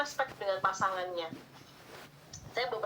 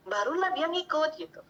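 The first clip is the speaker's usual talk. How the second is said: ordinary delivery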